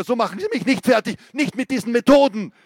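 high, loud and fast; defiant yelling. Acted. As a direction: rail against his methods. Defiant shout continues.

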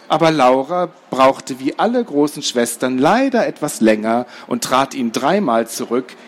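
ordinary delivery, nothing marked

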